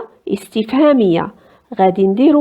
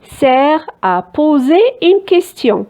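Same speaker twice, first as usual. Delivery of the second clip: slow explaining; 3 semitones higher, much slower than usual. Shown as a demonstration. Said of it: "La phrase".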